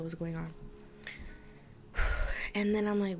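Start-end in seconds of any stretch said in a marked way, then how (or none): 1.9-2.5 heavy audible sigh. Real, not acted. Storyteller pausing before the next disturbing part, sighing. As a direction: let out a long breath. Pauses amid a distressing story.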